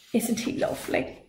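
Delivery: unvoiced sweet whisper; soft whisper. Una coos to the giant about the baby.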